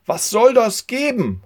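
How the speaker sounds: a man below calling up at the roof. A narrator's reading. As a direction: shout up at the roof. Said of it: "ruft einer von unten".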